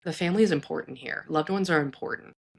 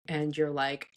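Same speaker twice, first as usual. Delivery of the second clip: ordinary delivery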